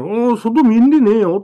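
高く強く止める祖母の声; warning. Retold or imitated. Grandma warns child. (外を見ようとする孫を、祖母が強く止める声で)

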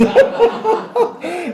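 loud high-pitched laugh; a real, delighted laugh; squealing laugh; high burst of laughter. Real, not acted. Laughs at his own joke.

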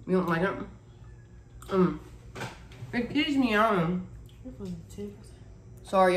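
speaking between bites; talking while chewing. Real, not acted. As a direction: mouth partly full of food. Seen 0: Left host chews bitten mochi throughout, jaw working between words.

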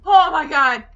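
very loud cry of disbelief; shocked yell. Real, not acted. Player just after a big game scare, shocked yell. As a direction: yell it out, shaken.

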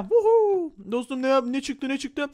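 excited woohoo; joyful whoop. Real, not acted. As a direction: whoop with delight. Cheering new weapon.

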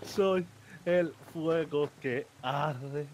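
slow, low, ceremonial chant; solemn incantation. Pretend. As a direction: intone it like a ritual spell. Repeats the mock incantation. (recitación solemne)

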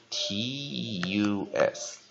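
letters "T U S" spelled very slowly; slow spelling. Shown as a demonstration. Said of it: "有的人会这样背". (慢慢拼出字母)

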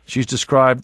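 usual speaking voice

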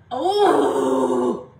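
exaggerated hold-it-in hoot; drawn-out "ooh". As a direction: let out a big held "oooh". Acts out holding back from trash talk.

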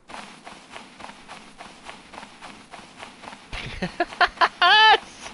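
breathy giggle. Real, not acted. Laughs at game.

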